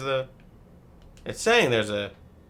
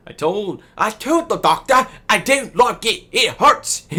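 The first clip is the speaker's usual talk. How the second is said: much higher, louder put-on accent as a sick patient; whiny patient voice; 14 semitones higher, 11 dB louder than usual. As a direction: whine like a child after surgery. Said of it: "What is this accent?".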